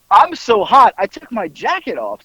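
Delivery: ordinary delivery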